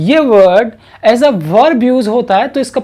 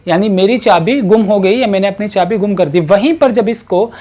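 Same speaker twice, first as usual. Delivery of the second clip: ordinary delivery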